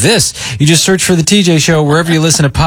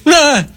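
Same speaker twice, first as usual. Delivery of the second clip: high imitated yelp; startled yelp; 13 semitones higher than usual. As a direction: squeak it out like a startled stranger. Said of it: "and he goes".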